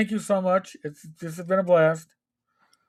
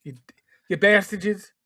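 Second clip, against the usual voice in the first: New York accent. Shown as a demonstration. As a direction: punch it out like a New Yorker. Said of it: "in my best New York accent".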